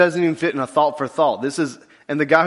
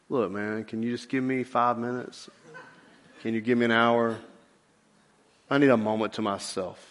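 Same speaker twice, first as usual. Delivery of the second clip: softer, low and tired; mopey morning voice; 5 semitones lower, 8 dB quieter than usual. Retold or imitated. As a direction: sulk as if just woken. A melancholy morning person talking.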